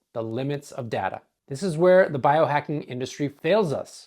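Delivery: ordinary talk, nothing marked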